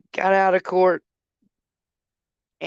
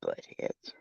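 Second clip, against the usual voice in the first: whispered insult; 14 dB quieter than usual. Real, not acted. Muttered insult.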